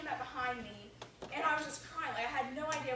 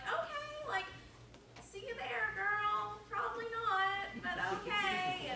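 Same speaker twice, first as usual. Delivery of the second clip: high, drawn-out mock acceptance; doubtful agreement; 9 semitones higher, slower than usual. Teen answering a friend's church invitation, high-pitched sceptical okay. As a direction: stretch it out high, humoring the invitation.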